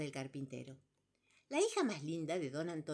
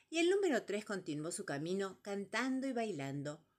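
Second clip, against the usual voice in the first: ordinary delivery, nothing marked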